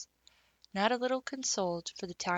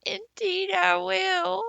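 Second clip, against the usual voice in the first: high slow sobbing promise; tearful girl vowing loyalty; 13 semitones higher, 10 dB louder, slower than usual. A narrator's reading. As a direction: push the words through tears. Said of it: "sobbed Diana".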